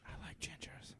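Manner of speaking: breathy sweet-nothing whisper. Acted. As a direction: whisper it intimately. Whispering to sponsor.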